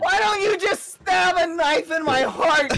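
laughing. Real, not acted. Laughing mock betrayal.

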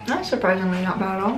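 nibbling between words; talking while chewing. Real, not acted. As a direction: mouth partly full. Seen 0: Woman holds a snack and bites it repeatedly during speech.

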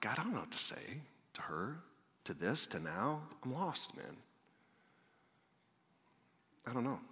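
very soft, half-whispered plea; hushed helpless prayer. Retold or imitated. Preacher retelling his silent prayer for words, hushed and helpless. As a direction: murmur it privately to God, at a loss.

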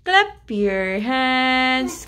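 very slow, stretched "Clap your hands"; drawn-out singsong instruction; slow prompt. Real, not acted. Parent repeats it as slow chant.

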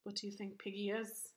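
ordinary delivery

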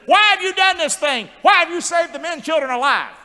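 high, loud outraged questioning; indignant king. Acted. King of Egypt confronts the midwives, indignant.

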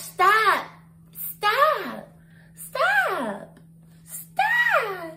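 escalating mock stop. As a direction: build from firm to a wailing, hammed-up shout. [name] repeated stop, escalating.

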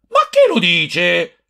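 defiant challenge. A narrator's reading. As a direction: throw it back at him as a dare. Quarrel over the bottles. (sfida provocatoria, più acuta e lenta)

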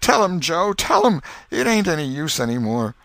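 high, loud broken surrender; vanquished plea. Acted. Beaten Potter urges Injun Joe to confess, despairing. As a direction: give up, begging Joe to tell. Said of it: "vanquished gesture".

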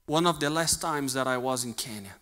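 ordinary talk, nothing marked

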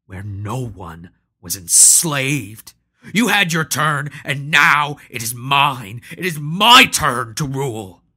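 a hard, loud retort, the opening slowed. Acted. Patro retorting at Hanno, claiming his turn, loud and hard. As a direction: throw the words back hard. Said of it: "Patro retorted".

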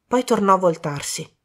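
ordinary delivery, nothing marked

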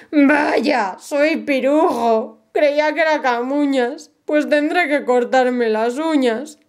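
high, slow resigned sigh of a voice; the wizard admitting defeat, resigned and slow. Acted. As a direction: give up and accept it. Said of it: "dijo con resignación".

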